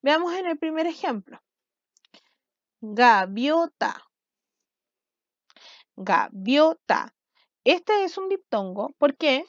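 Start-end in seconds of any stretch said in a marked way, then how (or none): 2.8-4.0 GAVIOTA very slowly in separate syllables, ga-vio-ta; teacher sounding out GAVIOTA as ga, vio, ta. Shown as a demonstration. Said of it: "cuántas sílabas tienen". 5.9-7.1 GAVIOTA very slowly in separate syllables, ga-vio-ta; teacher sounding out GAVIOTA as ga, vio, ta. Shown as a demonstration. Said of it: "cuántas sílabas tienen".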